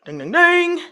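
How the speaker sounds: high sung-out ding ding ding; playful bell imitation; mock timer ding.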